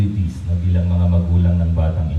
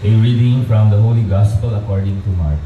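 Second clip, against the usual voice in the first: steady, measured proclamation at the lectern; Gospel reading. Acted. Priest reads the Gospel to the baptism family, proclaiming. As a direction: read it out formally for the whole church.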